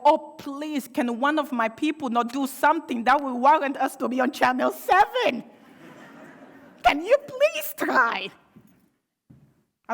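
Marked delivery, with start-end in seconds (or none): 0.0-8.3 much higher, louder, rising plea stretched out at the end; pleading exasperation. Retold or imitated. Watching the news, begging her community not to make headlines, higher and louder with rising exasperation. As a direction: beg your own people with comic desperation. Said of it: "Because I go".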